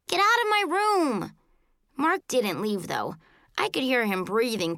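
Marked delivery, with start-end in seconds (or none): 0.1-1.3 very high yelled order. A narrator's reading. Child ordering brother out of the room, high yell. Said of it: "I yelled".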